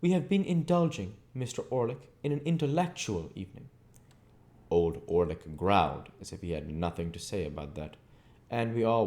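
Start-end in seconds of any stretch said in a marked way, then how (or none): 0.0-3.7 lofty, raised theatrical tone, voice up high; exalted, pompous. A narrator's reading. Wopsle boasting to Orlick after the play, exalted. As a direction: puff up like an actor fresh off stage. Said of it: "exalted with his late performance".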